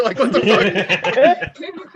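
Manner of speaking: incredulous laugh-talk. Real, not acted. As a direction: stretch it out, laughing in disbelief. Laughing disbelief at psychic.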